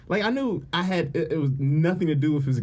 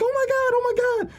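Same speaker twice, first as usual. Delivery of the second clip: squealing, much higher; high, louder giddy squeal; excited friend; 4 dB louder than usual. Retold or imitated. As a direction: squeal it like thrilled news. Imitating excited friend on phone.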